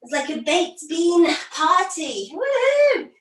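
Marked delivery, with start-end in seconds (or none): 2.3-3.1 excited whoop. Real, not acted. Teacher cheering kids on, excited whoop. Kids yoga rocking game.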